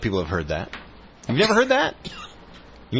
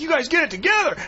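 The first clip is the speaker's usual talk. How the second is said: floor director yelling. Retold or imitated. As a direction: shout the countdown at the bickering anchors. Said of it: "I'm just yelling at 'em like".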